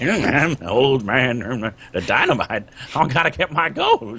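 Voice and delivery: put-on slow, drawn-out old miner; old prospector voice. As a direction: play a grizzly gold prospector. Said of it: "That was kinda Douglas's voice".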